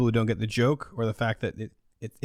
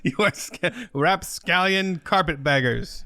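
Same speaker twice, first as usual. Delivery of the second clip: mock pirate insult; 6 semitones higher, slower than usual.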